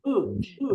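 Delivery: উ said lightly and briefly; barely touch the উ, light and quick; light vowel. Shown as a demonstration. Teacher models the light উ sound for students, light and quick. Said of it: "হালকা ভাবে বলবো".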